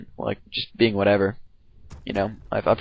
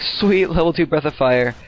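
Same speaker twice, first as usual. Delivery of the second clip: excited inner voice; 5 semitones higher, slower than usual. Retold or imitated. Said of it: "I'm like".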